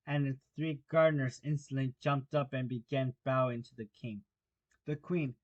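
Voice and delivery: ordinary delivery, nothing marked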